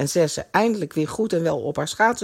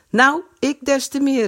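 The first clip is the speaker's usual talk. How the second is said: higher-pitched eager call; a lively, raised-pitch exclamation; 7 semitones higher than usual. A narrator's reading. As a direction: pipe up, calling it out. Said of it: "riep Kees".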